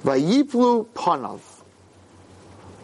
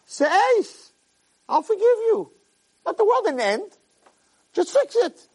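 much higher, pleading voice that begs him to fix it; God urging; 11 semitones higher than usual. Acted. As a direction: coax him to try again. God urges Cain to improve.

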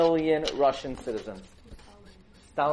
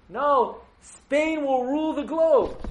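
a higher, much louder, slower proclamation of conquest; grandiose explorer; 11 semitones higher, 5 dB louder, slower than usual. Acted. Columbus boasting to the king and queen, grandly loud. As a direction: announce it like destiny itself.

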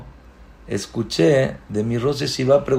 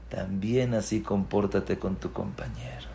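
hushed whisper; soft secret instruction; whispered moral; 4 semitones lower, 10 dB quieter than usual. Acted. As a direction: lean in and whisper. Lesson's final line.

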